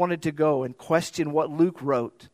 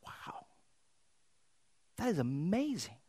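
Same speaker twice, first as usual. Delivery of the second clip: breathy whispered wonder, very soft; awed whisper; 10 dB quieter than usual. Retold or imitated. Preacher imagining the miracle, whispering in awe. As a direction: whisper it in amazement. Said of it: "I'm like".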